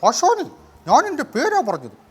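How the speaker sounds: very high, loud comic sidekick; Indrans. Acted. Ponnappan insisting that is his name, high comic impression. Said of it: "ജനാർദ്ദനും ഇന്ദ്രൻസും".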